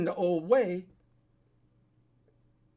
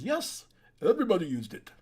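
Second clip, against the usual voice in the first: put-on lightly done Austrian accent, a bit louder; terrible Arnold Schwarzenegger accent; 3 dB louder than usual. Vlogger quotes Arnold on steroids in a weak Austrian accent. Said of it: "that's a terrible Arnold accent".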